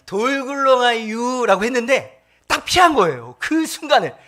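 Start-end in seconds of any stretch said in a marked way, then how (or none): 0.1-1.6 put-on very slow, drawn-out Chungcheong accent; 느릿한 충청도 사투리. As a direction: stretch it lazily in a Chungcheong accent. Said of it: "같이 올라가서".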